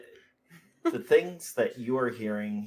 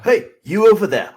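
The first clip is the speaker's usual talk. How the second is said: loud high taunt; taunting gamer; 9 semitones higher, 14 dB louder, faster than usual. Acted. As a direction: call out across the room, loud and higher.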